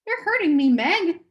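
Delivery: sharp, high and loud protest; sharply. Acted. A boy to his sister gripping him, sharp and high. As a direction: snap it out, hurt and angry. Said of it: "Charles said sharply".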